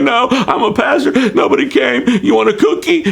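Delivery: high, wailing sobbed words; crying pastor. Retold or imitated. Pastor sobbing to the policeman who pulled him over. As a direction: blubber it out through tears to the cop. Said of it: "I'm bawling and I'm crying".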